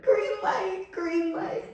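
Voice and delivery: high, choked-up cry of joy; emotional high voice, softening; tearful bawling. Retold or imitated. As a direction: act out saying it through happy tears. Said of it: "My husband and I were bawling".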